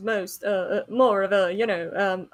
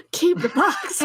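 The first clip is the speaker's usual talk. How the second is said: laughing through the words, slower; laughing refusal; 8 semitones higher than usual. Real, not acted. Player laughing, refusing the thirty bucks. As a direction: gasp it out mid-laugh.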